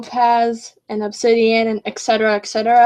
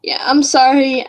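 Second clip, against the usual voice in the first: ordinary talk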